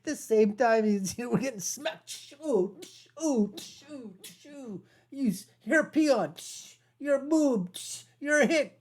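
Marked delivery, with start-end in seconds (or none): 2.1-4.8 mock shooing. Mocking being shooed away. 5.1-8.8 mock insulting sneer. Mimicking insults aimed at viewers.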